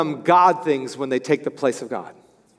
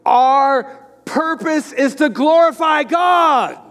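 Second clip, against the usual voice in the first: slow, loud declaration, pitched much higher; retold near shout; 8 semitones higher, 3 dB louder, slower than usual. Board member Arnie declaring the church's purpose, near shouting. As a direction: proclaim it like a burst of passion. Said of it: "he almost shouted in the room".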